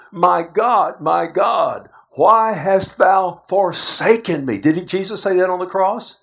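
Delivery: loud, high impassioned preaching; full-force preaching. Acted. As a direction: preach it out to the congregation, fervent.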